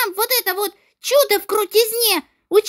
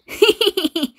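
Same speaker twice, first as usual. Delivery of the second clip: mocking laugh. Kids mocking in classroom quarrel.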